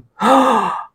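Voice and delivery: big, audible gasp of astonishment; amazed gasp. Acted. Preacher reacting to the Bible verse, gasping in amazement.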